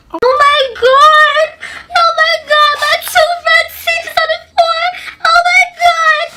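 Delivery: high, loud mock wailing; hammed-up crying. Storyteller reliving losing two front teeth, mock bawling. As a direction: wail it out like a distraught first grader. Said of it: "I just started to cry".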